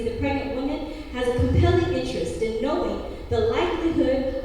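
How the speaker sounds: loud, stiff bill recitation; formal legal reading. Acted. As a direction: read it like a statute. Reads bill text in poem.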